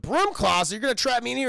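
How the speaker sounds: indignant disbelief. Retold or imitated. Streamer voicing Terry objecting to the broom closet, indignant.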